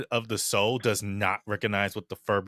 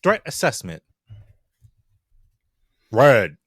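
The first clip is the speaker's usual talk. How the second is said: flat machine scan, the verdict punched much louder; robot readout; 9 dB louder than usual. Acted. Rio the construct sizing up a spider swarm, robotic. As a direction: report it like a combat robot.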